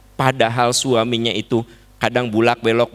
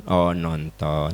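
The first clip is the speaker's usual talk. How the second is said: low, soft, slow sulky oh, nonton; sulky disappointment; 9 semitones lower, 5 dB quieter, much slower than usual. Acted. A mother hears her child went to the movies, sulking. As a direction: let it drop, hurt and sulking. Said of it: "Ibu teh jangan ngambek".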